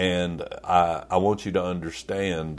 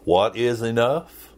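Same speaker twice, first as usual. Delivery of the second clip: normal talking voice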